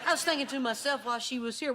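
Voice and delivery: normal talking voice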